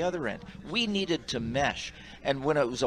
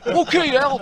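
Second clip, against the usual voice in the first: put-on much higher, louder kid's reply; lectured kid; 11 semitones higher, 11 dB louder than usual. Ref answering the coach like a lectured kid. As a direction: answer like a scolded boy. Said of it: "like a little kid that just got lectured".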